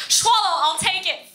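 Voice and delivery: high, loud delighted pick; high, loud delighted choice; found it. Pretend. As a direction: snap it up like a bargain. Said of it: "I'm like".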